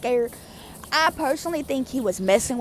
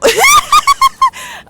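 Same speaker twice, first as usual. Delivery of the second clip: loud, high-pitched burst of laughter; real high cackling laugh; 12 dB louder than usual. Real, not acted. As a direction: crack up at your own joke. Laughs at own joke.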